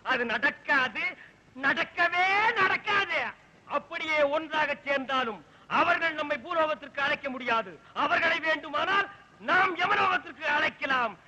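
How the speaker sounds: usual speaking voice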